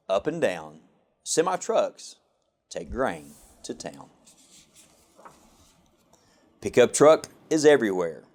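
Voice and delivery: plain reading voice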